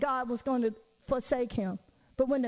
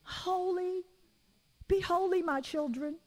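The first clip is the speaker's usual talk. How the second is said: much higher, drawn-out airy holy voice; mock floaty God; 7 semitones higher, slower than usual. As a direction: float the words like a dreamy heavenly being. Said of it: "God is just like".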